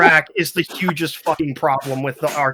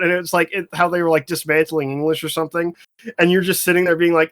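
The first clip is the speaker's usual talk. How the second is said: usual speaking voice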